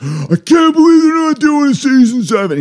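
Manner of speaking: squeaky put-on complainer voice; mock whiny complaint. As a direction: whine it like a spoiled fan.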